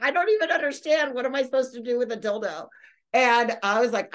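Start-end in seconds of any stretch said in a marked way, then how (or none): 0.0-2.7 higher-pitched voice, hurrying at the end; mock bewilderment. Friend questioning a parting gift, retold bewilderment. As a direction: imitate a baffled friend, raised pitch.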